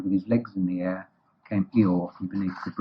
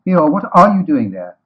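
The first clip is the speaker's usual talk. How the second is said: raised pitch, much louder; demanding question; 7 semitones higher, 10 dB louder than usual. Acted. Rabbit questioning Eeyore in the river, loud demand. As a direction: ask sharply and loudly.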